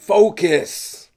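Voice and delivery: booming high shout of focus; the Rock. Retold or imitated. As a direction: bark it like a hyped action star. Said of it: "Got the Rock's voice in my head".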